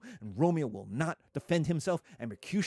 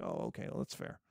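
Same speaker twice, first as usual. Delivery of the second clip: mock resigned mumble; 11 semitones lower, 11 dB quieter, faster than usual. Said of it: "I'm like".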